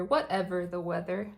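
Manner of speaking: plain reading voice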